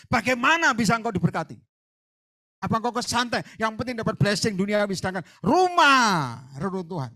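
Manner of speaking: loud, high, driving preacher's rebuke; fiery preaching. Acted. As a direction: hammer the congregation with holy anger. Preaching at full force. (khotbah berapi-api dengan suara keras dan tinggi)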